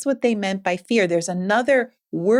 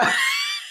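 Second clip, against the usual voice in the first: shrieking laugh. Real, not acted. Wild laugh at the joke.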